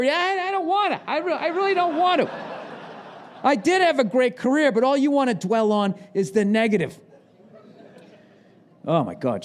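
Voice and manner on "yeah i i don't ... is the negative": high, whining, sulky ex-quarterback; lightly voiced reluctant has-been; whiny Jim Kelly. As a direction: whine like a sore loser who wants no interview. Jim Kelly acted whining reply.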